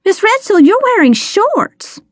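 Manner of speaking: amazed at what you are seeing; astonished cry. A narrator's reading. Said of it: "I cried".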